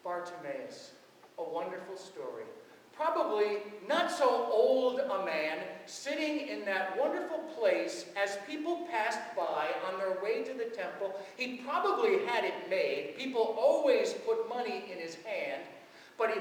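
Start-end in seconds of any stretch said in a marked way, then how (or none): none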